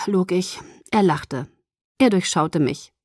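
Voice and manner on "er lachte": usual speaking voice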